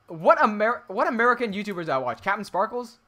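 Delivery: ordinary talk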